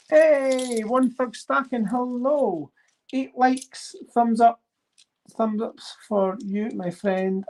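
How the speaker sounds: light up for your viewer; cheery shout-out. Real, not acted. Greeting viewer One Thug Stacking on the channel, cheery high shout-out.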